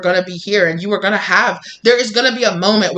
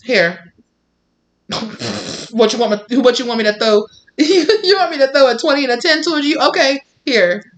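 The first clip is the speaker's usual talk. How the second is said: higher, stammering mock reluctance; flustered date; 5 semitones higher than usual. Date grudgingly hands over cash, hammed-up stammer. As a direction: stumble over the handover, half-mocking. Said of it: "they start to".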